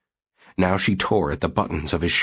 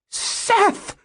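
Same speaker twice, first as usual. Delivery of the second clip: a shrill, piercing call of his name; shrill cry. Acted. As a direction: shriek his name in shock. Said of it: "in a shrill voice".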